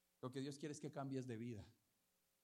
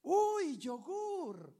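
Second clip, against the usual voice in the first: much higher, louder cry of delight; excited shout; 12 semitones higher, 9 dB louder, much slower than usual. Retold or imitated. Woman shouting to the pastor at his car, joyful yell. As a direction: yell it out across the parking lot. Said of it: "la señora grita".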